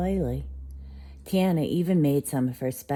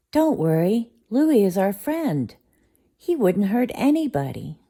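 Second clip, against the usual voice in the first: higher, louder comforting; reassuring; 6 semitones higher, 6 dB louder than usual. Acted. Tiana reassuring Stella, higher and comforting. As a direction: soothe the scared dog.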